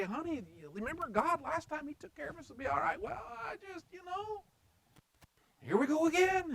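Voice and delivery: high, resigned wife's voice; resigned Sarah. Retold or imitated. Sarah answering Abraham's second sister scheme, in a high, resigned voice. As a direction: sigh it out, here we go again. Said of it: "surely she had to say".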